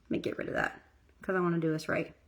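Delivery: hushed unvoiced self-talk; quiet mutter to self while fixing makeup; whispered aside. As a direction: whisper it to yourself. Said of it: "I was like".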